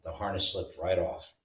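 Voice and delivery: ordinary delivery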